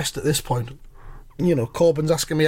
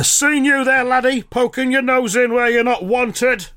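a hint of a higher, scolding old man; lightly voiced Corbyn; 6 semitones higher than usual. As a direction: tease him like a nosy neighbour's scolder.

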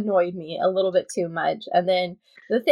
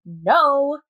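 husband's exasperated protest, lightly imitated; mock frustration; 3 semitones higher, slower than usual. Wife imitating her husband watching Ross lie, mildly exasperated. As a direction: retell a mildly frustrated objection. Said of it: "he gets so frustrated".

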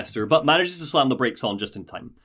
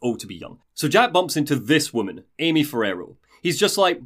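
ordinary talk, nothing marked

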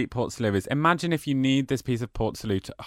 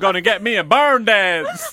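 laughing hoedown twang, higher and louder; mock cowboy; 8 semitones higher, 5 dB louder than usual. Co-host joking about barn dances in a laughing cowboy twang. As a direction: ham up a Texan barn dance line through laughter.

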